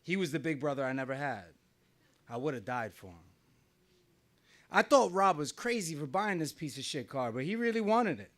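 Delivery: ordinary delivery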